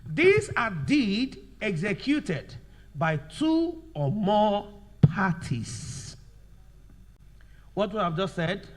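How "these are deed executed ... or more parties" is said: very slow, words drawn out and spaced; slow dictation. Shown as a demonstration. Teacher dictating a definition to students, slow dictation. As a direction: dictate slowly so they can write it down. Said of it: "Now let's write".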